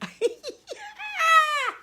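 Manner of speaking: squealing burst of real laughter; high-pitched laugh. Real, not acted. Mother reading her son's joking comment, laughing.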